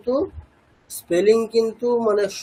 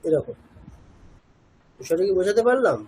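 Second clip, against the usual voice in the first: normal talking voice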